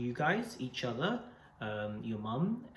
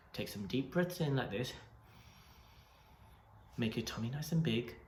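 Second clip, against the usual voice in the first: guided meditation; 6 dB quieter than usual. Acted. Leads breathing meditation.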